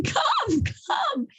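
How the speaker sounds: a high called-out come, come; father yelling from his seat, high-pitched. Retold or imitated. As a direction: call the boy across the shul. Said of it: "like, yelling".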